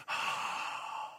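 long audible exhale; heavy breath. Shown as a demonstration. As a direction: breathe out heavily and slowly. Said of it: "Somebody breathe heavily".

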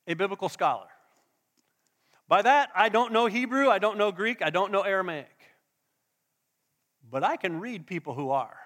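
normal talking voice

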